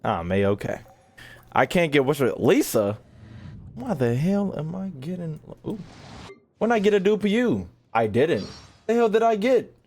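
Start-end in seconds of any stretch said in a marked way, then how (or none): none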